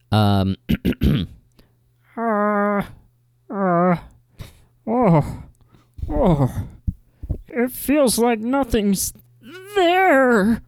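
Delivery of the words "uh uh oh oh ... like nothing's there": very high, drawn-out sitcom actor impression; Ray Romano. Final contestant in impression contest, high drawling impression. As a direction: warm up with groans, then stretch the slogan high and slow. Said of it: "I've always loved your Ray voice".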